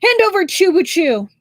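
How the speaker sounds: sneering loud demand for the prisoner. A narrator's reading. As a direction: snap it at him in contempt. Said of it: "Xiao Xian sneered".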